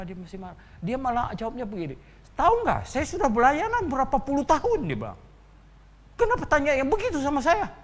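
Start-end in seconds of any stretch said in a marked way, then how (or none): 2.3-4.9 high, loud imitated indignation; a veteran servant bristling at the question; an offended student snapping back at the questioner, loud; angry retort. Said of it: "Dia marah besar". 6.2-7.7 high, loud and fast imitated outrage; the same offended student demanding why he is asked this, loud and fast; angry retort. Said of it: "Dia marah besar".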